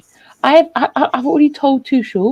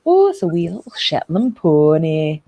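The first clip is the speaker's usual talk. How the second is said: mock Scottish phrase, lightly put on; a playful hint of a Scottish brogue; lightly voiced Scottish; Scottish accent; 2 semitones lower than usual. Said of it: "the only thing I can say is Scottish".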